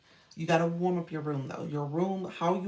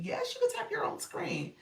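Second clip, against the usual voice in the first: excited yes. Real, not acted. Excited answer to viewer.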